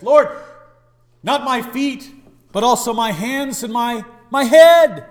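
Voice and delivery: high, loud, overeager plea; eager Peter. Acted. Preacher voicing Peter begging Jesus to wash him wholly, excited. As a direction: burst out wanting everything washed.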